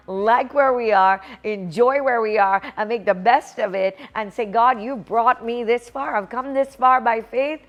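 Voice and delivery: normal talking voice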